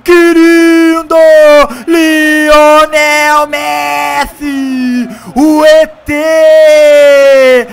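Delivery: screaming golaço, high and loud; explosive goal commentary. Acted. Goal scored in the match.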